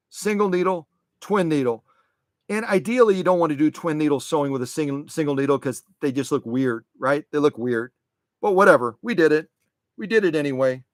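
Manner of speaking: ordinary delivery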